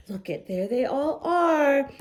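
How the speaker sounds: plain reading voice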